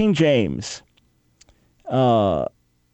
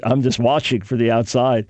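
ordinary talk

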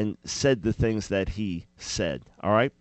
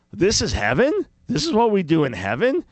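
incredulous mockery; 8 semitones higher, 5 dB louder than usual. Mocking a dull view of heaven.

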